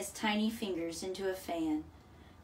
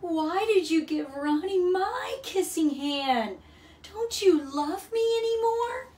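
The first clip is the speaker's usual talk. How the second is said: put-on high, loud, hurt child's voice; a little one pleading through hurt; wounded young raccoon begging his mother; saddest voice; 11 semitones higher, 7 dB louder than usual. A narrator's reading. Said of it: "in the saddest voice".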